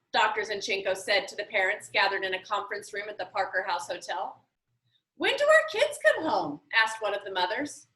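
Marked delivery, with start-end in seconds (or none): 5.2-6.6 high, anxious mother's voice; a mom pressing for answers, pitched up; worried mother. Acted. Worried mother questions librarian.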